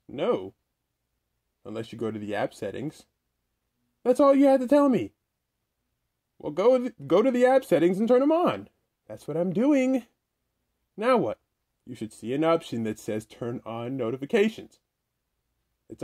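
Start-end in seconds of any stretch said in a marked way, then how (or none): 4.0-11.4 high, impatient nagging voice; squeaky character voice. Acted. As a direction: whine it at him, pitched way up. High pitched character argues.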